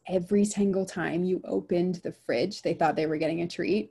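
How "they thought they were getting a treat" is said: normal talking voice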